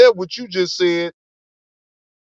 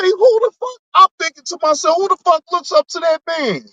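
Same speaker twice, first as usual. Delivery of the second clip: much higher, stunned disbelief; incredulous outburst; 11 semitones higher than usual. Retold or imitated. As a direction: squeal it like you can't believe it. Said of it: "I say".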